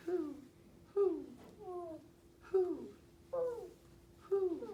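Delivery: short, high owl hoots. Shown as a demonstration. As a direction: hoot like an owl, one call at a time. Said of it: "why am I saying it like that".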